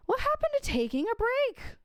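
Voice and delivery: sudden high, startled complaint; a high-pitched what-happened; baffled outcry. Retold or imitated. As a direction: throw up your hands in disbelief. Exasperated at sudden news.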